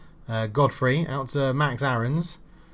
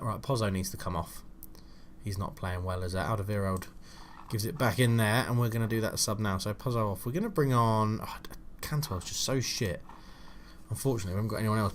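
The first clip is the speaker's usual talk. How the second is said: muttered aside; 3 semitones lower, 9 dB quieter than usual. Real, not acted. Muttering over substitution.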